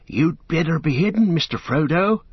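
anxious Sam. A narrator's reading. Sam urging Frodo to hide from the rider, anxious. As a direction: press him to hide, nervous. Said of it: "said Sam anxiously".

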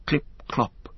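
breathy, hushed clip-clop; hushed hoofbeats. A narrator's reading. Narrator sounding out a rider's hoofs in the fog, hushed. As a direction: whisper the hoofbeats coming closer.

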